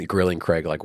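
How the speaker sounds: ordinary talk, nothing marked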